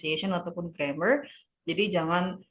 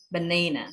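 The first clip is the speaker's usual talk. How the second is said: banana as ba-NAY-na; banana, weight on the middle syllable; stress on second syllable; 2 semitones lower than usual. Shown as a demonstration. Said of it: "penekanannya di suku kata kedua".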